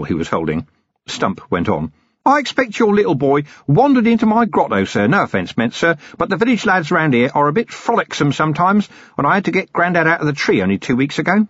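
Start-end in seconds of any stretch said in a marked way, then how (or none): 2.2-11.5 higher, eager rambling hermit voice; a cheerful, long-winded hermit eager to explain; obliging old hermit rattling on to the Commander, pitched higher; chatty old hermit. Acted. Hermit chats on.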